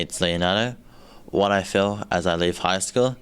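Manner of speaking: ordinary delivery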